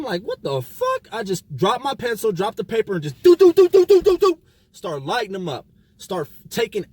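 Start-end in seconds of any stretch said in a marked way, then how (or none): 0.2-1.1 a sudden high what-the-hell reaction; retold shock, pitched way up; stunned outburst. 2.9-4.4 loud, high do-do-do punch noises; playful imitation of a flurry of punches; mock punch sounds. Imitating his punches in the fight.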